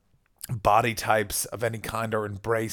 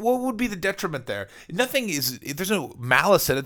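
ordinary talk, nothing marked

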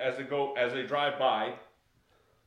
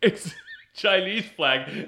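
ordinary talk

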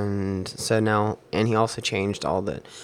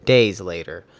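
louder dramatic storytelling read; spoken-word story; 13 dB louder than usual. Acted. Reading his sci-fi war story aloud over music, dramatic narration. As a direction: tell the war tale to the beat, louder.

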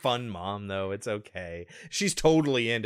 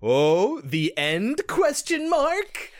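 high, loud, drawn-out teaser; mock spooky movie tagline, slow finish; mock-dramatic; 12 semitones higher, 6 dB louder, much slower than usual. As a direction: ham up a cliffhanger ending. Mimics an ambiguous movie ending.

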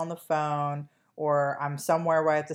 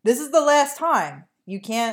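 louder, higher protest; exasperated insistence; 8 semitones higher, 6 dB louder than usual. Real, not acted. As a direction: press your co-host, raised pitch and volume. Pressing co-host to join in.

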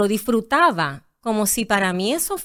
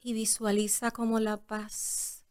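hushed meditation guide; yoga teacher voice; 7 dB quieter than usual. Shown as a demonstration. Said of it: "en tu voz de maestra de yoga".

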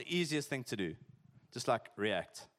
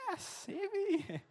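very high, slow mock voice; mock high drawn-out local; much slower than usual. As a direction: stretch it out like a drained local.

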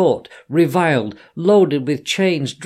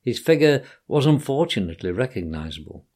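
ordinary delivery

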